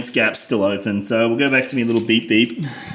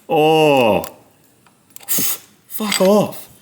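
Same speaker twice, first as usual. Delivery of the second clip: annoyed outburst; 6 semitones higher, much slower than usual. Real, not acted. Swatting mozzies, annoyed.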